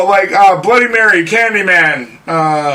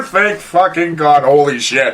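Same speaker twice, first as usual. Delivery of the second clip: ordinary talk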